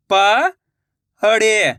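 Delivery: पढ़े drawn out slowly, syllable by syllable; slow syllable split. Shown as a demonstration. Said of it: "मैं किस तरीके से".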